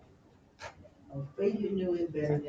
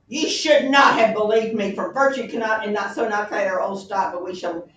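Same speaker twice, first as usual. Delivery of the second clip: harshest tone; 5 semitones higher, 14 dB louder than usual. Acted. Hamlet turning on Ophelia, harsh and loud. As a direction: spit it at her in cold rage. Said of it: "in the harshest tone imaginable".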